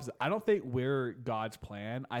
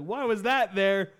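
loud, high, drawn-out demand; hammed-up exasperated yell; mock outrage; 8 semitones higher, 10 dB louder, slower than usual. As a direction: shout it slowly in comic fury. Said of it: "He just gets pissed".

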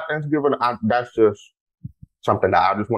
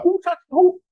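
very high mock outrage; incredulous squeal. Real, not acted. Host complaining about a sink of dirty dishes, very high. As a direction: squeak in disbelief.

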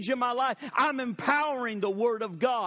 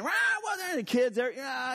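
imitating kitchen noise; 6 semitones higher than usual. Noisy kitchen chatter imitated.

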